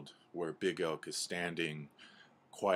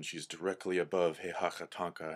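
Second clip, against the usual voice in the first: plain reading voice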